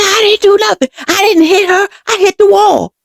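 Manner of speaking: high-pitched, upset protest, retold; frantic denial. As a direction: protest frantically as the accused brother.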